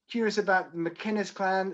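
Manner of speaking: normal talking voice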